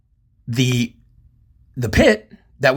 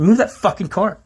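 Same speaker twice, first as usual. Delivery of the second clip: retold angry order; 8 semitones higher than usual. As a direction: act out ordering someone out of the way.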